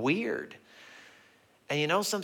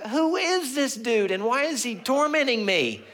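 exasperated disbelief; 13 semitones higher, 5 dB louder than usual. Retold or imitated. Tired father's acted inward outburst.

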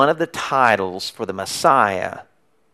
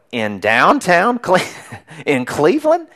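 incredulous surprise; 5 semitones higher, slower than usual. Retold or imitated. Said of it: "They immediately go".

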